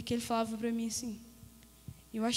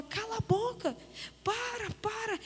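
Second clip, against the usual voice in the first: high-pitched mock yell, held back in volume; grito imitado; 8 semitones higher, 3 dB quieter than usual. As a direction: retell a desperate plea without really shouting. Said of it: "Eu gritava, eu colocava a mão no ouvido". (grito contido e agudo, imitado)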